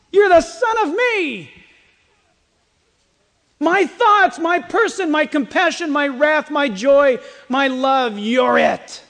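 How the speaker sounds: high, loud, ringing declaration; exultant proclamation. Acted. Preacher voicing the Father to his Son, exultantly proclaiming. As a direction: proclaim it at full force, pitched high.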